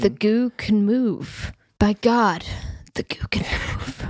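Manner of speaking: slow, fading to a whisper; hushed awe. Pretend. Joking about the goo, slow awed whisper. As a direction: marvel at it in hushed wonder.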